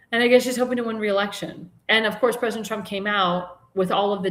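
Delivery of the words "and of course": ordinary talk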